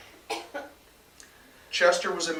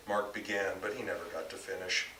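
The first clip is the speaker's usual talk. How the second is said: usual speaking voice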